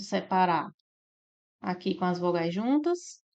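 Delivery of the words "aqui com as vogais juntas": ordinary talk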